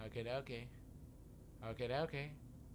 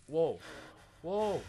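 loud, rising alarm; startled whoa; 4 semitones higher, 9 dB louder than usual. Pretend. Player caught out by the freezing pod, startled. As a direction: cry out louder the second time.

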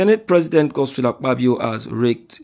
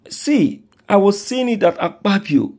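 much higher, louder outburst; indignant outburst; 9 semitones higher, 4 dB louder than usual. Real, not acted. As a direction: burst out high and indignant. Outburst, sharp jump in pitch.

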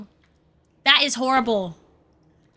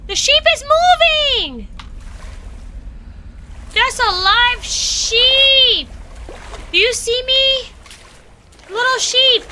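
high-pitched, thrilled exclamations; excited discovery; 8 semitones higher, slower than usual. Real, not acted. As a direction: cry out in amazed excitement, voice high. Spots a living sheep.

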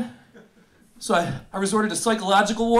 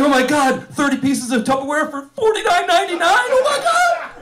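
higher pitch climbing to a squeal; over-excited; 8 semitones higher than usual. Acted. Thirty-something reads a Groupon deal, hammed-up excitement. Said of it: "I get excited for Groupon emails".

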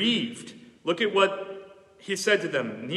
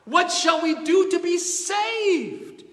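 much higher, slow anguished shout; crying out; 13 semitones higher, 4 dB louder, slower than usual. Retold or imitated. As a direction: call it out in desperation. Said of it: "The people cried out".

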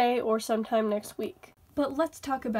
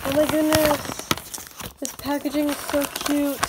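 excited gush; 3 semitones higher, 6 dB louder than usual. Real, not acted. Gushing over cute packaging.

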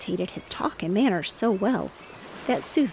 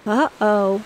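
louder dismayed uh-oh; mild loud dismay; cried; 7 dB louder than usual. Acted. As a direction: react loudly to the man's fall. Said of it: "cried Bunny's sister Sue".

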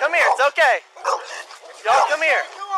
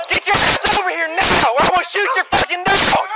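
usual speaking voice

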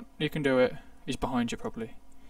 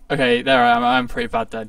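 flustered outburst; 6 semitones higher, 10 dB louder, faster than usual. Real, not acted. Flustered after a mistake.

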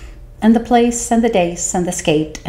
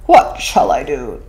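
uneasy whale; 6 semitones higher, 4 dB louder than usual. Acted. Whale complaining to the fish about the man. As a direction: grumble it like an upset whale. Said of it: "So he said to the 'stute fish".

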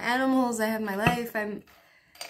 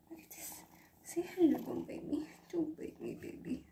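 soft whispered coaxing to the cat; 6 semitones higher, 10 dB quieter than usual. Real, not acted. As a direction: hush and gently warn the kitten.

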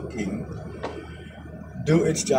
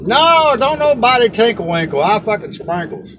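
louder, higher protest; indignant protest; 6 semitones higher, 6 dB louder than usual. Real, not acted. Cook protests being teased, loud indignant denial. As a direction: deny it with mock outrage.

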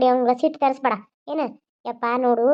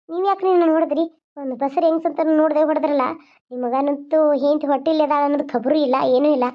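ordinary talk

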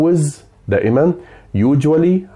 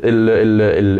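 ordinary talk, nothing marked

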